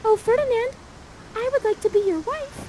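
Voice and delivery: put-on high, gushing young maiden; high girlish Miranda. Miranda proposing to Ferdinand, in a high gushing voice. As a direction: propose sweetly. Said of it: "Miranda says".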